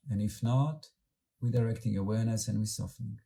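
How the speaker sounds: calm, unhurried meditation guide with long pauses; guided meditation. Acted. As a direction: gently lead the group inward, letting words settle.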